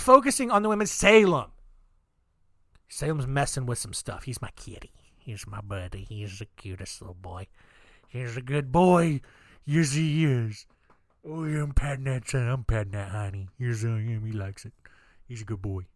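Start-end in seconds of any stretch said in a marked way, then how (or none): 4.2-15.8 soft, low cooing to a pet cat. Real, not acted. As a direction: coo over the kitty like a proud owner. Talking to his cat Salem.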